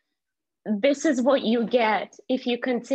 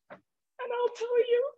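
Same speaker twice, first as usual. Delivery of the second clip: choked up and crying while recalling war videos; 13 semitones higher than usual. Real, not acted. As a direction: voice breaking, much higher, in tears. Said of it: "I'm actually getting emotional".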